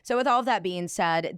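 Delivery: usual speaking voice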